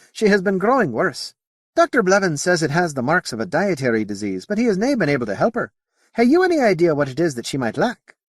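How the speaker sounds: concerned Scotsman asking for help; Scottish accent. Acted. Potter asks about the sick Brown, Scottish accent. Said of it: "it's the accent".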